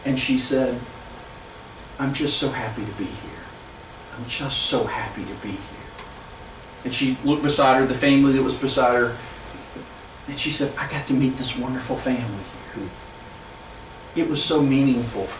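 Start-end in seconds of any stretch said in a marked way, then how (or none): 2.0-5.6 soft, low voice of a moved woman; retold tearful gratitude, hushed and lower; tearful happiness. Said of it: "and she's crying". 10.8-12.9 softer retelling of a moved woman; understated retold tearful gratitude; tearful happiness. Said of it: "and she's crying".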